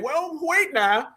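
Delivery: imitating a protester, slow and high; mock objector. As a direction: draw out each word in mock objection. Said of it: "some Negro saying".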